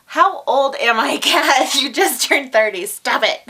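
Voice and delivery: loud, high self-teasing outburst; high, exasperated telling-off with a laugh; mock self-scolding. Real, not acted. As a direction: scold yourself loudly in comic exasperation. Mock-scolds herself over saying youngsters.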